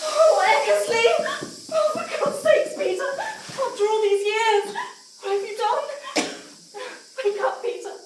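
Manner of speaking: desperate to wake him; frantic. Acted. Wife finds Peter in the gas, frantic pleading.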